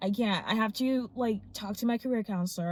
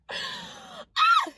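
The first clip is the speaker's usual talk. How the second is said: joyful shout. Real, not acted. Celebrating the job offer with a happy scream.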